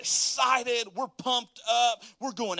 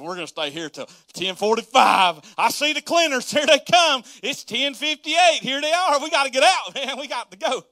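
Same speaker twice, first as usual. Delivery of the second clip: higher and louder, hurried hammed-up panic; mock panic; 6 semitones higher, 8 dB louder than usual. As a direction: rush it as the cleaners arrive. Last-minute guests see cleaners coming.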